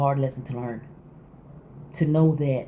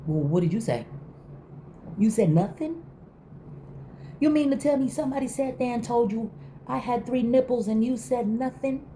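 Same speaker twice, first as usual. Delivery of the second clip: indignant; 8 semitones higher than usual. Retold or imitated. Confronting friend in imagined retort.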